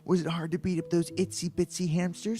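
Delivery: put-on high, loud perky girl voice; gushy teasing girlfriend, high-pitched. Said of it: "impression of either Hilary Duff or Ava Longoria".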